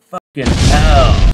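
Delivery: stretched-out roar of mock horror; loud exclamation. Real, not acted. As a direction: let the twist hit you hard. Reacts to the aunt twist.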